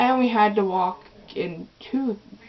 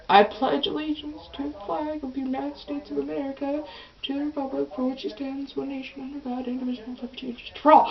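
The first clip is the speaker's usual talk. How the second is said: mumbled pledge; 4 semitones higher, 8 dB quieter, faster than usual. Retold or imitated. Embarrassed girl reciting pledge.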